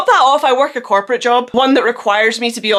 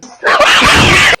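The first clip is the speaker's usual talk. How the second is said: squeaky burst of laughter; high squealing laugh; 7 dB louder than usual. Real, not acted. Laughs at own rant.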